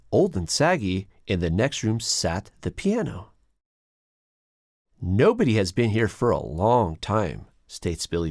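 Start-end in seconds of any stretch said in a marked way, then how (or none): none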